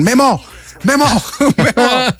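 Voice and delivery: high, drawn-out name call; mock-yell the name, laughing; imitated fan shout. Fans yelling for a photo, high imitated shout. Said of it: "me empiezan a gritar".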